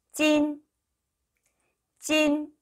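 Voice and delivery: 찐 stretched high and level; teacher's sustained high 찐; model pronunciation. Shown as a demonstration. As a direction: hold the vowel of 찐 on an even high note. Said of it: "이렇게 읽으시고요".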